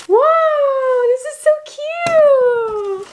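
high, slow delighted squeal; excited squeal. Real, not acted. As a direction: squeal over something adorable. Unboxing PR, squealing at cute item.

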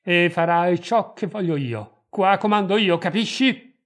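loud, high, bullying orders; domineering shout rising to a challenge; violent command. A narrator's reading. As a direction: bear down on the listener forcefully. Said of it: "appressandosi violento".

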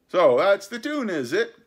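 high, loud pirate exclamation; cried retort. A narrator's reading. The cook answering his cheering crew, crying out. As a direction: crow back at the fickle crew. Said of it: "cried the cook".

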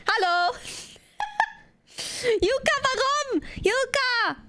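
high laughing squeal; excited squeal. Real, not acted. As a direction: squeal a greeting. Squealing greeting. (quietschende Begrüßung)

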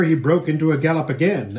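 plain reading voice

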